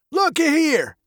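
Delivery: put-on high, gravelly old man's voice. A narrator's reading. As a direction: muse like a muddled old man, high and gravelly. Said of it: "his voice as old and gravelly as his face".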